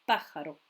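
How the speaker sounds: Pájaro punched on the opening PA, then falling; stress on first syllable. Shown as a demonstration. Teacher demonstrating where the accent falls, Pájaro stressed on the first A. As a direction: hit the accented A in Pájaro, let the rest drop. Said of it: "se situe sur le A accentué et pas ailleurs".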